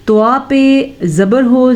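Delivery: ordinary delivery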